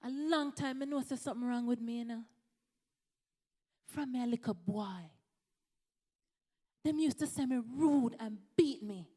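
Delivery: put-on retelling a patient's words in a broad Jamaican accent; strong Jamaican accent. Jamaican patient telling his doctor about his childhood, in a strong Jamaican accent. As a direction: slip fully into Jamaican patois. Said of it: "he said in his strong Jamaican accent".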